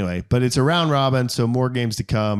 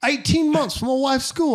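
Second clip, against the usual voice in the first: very high mock British voice; British accent. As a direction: imitate a put-on British accent. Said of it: "Where did this voice come from?".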